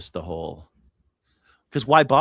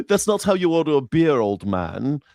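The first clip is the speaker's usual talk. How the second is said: hammed-up Brit correcting a foreigner; lightly done mock English accent; 3 semitones higher than usual. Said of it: "you mimicking an English person".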